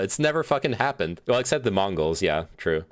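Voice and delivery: ordinary talk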